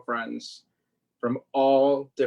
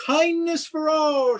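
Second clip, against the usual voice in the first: higher, louder, slow proclaiming; shout; 13 semitones higher, 6 dB louder, slower than usual. Acted. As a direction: call it out to the whole park. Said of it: "shouts Paletero Jose".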